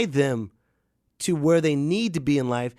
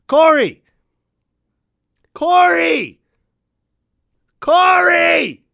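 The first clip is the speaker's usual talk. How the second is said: much higher, louder name shouted in vain; helpless name calling; 13 semitones higher, 9 dB louder, much slower than usual. Shown as a demonstration. Showing the audience how useless calling Corey is. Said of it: "See? It doesn't mean anything".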